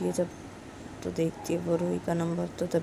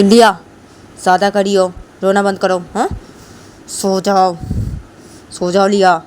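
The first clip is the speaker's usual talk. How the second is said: stern scolding; 2 semitones higher, 13 dB louder than usual. Acted. Parent scolds again.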